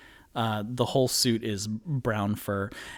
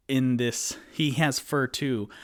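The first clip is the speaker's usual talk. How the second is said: usual speaking voice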